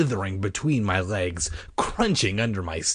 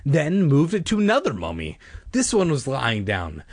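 ordinary delivery, nothing marked

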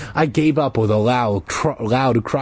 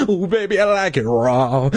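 mock sultry; 5 semitones higher than usual. Joke sultry aside.

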